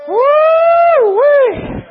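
high, loud, drawn-out "woo-wee"; preacher's whoop. Real, not acted. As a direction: whoop it out long in revival fervor.

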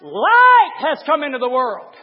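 loud, high-pitched hellfire sermon; thundering pulpit shout; full-force preaching. Acted. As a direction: preach it at the top of your voice.

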